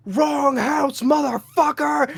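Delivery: high-pitched hammed-up battle yell; mock screaming. Man to supposed burglar, mock screaming. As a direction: act out screaming at an intruder. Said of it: "I'm just screaming".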